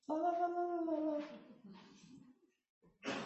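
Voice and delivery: high singsong la la; singsong baby talk. Pretend. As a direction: sing it sweetly to a baby.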